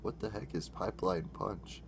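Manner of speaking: ordinary talk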